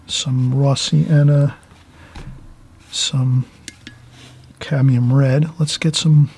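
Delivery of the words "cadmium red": ordinary delivery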